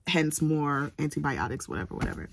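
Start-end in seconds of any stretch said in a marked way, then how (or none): none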